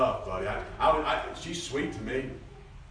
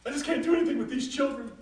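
higher pleading whine; whiny mother; 5 dB louder than usual. Retold or imitated. Mothers complain to the preacher in a whine. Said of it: "they're at the preacher".

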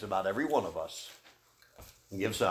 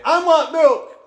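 put-on demanding child; 12 dB louder, slower than usual. Shown as a demonstration. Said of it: "Or you could season it with salt".

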